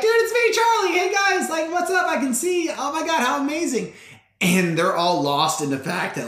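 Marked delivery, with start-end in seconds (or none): 0.0-3.9 very high-pitched overjoyed outburst; ecstatic insistence. Acted. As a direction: burst out high, easing down as you marvel. Healed Charlie insisting he can see.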